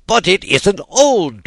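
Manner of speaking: high, loud, slow furious cry. A narrator's reading. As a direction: Tweedledum raging at Alice, high, loud and slow. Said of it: "with a fury greater than ever".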